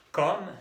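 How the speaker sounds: "Comme" dito à francesa, /kɔm/, curto, sem E final; French comme. Shown as a demonstration. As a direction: diga "Comme" como /kɔm/, subindo no fim. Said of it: "Y primero la pronunciación".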